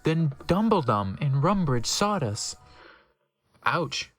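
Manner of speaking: ordinary delivery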